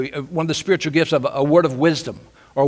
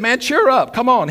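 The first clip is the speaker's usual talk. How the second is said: 1 eager encouragement; 7 semitones higher, 3 dB louder than usual. Acted. Friends encourage the beggar.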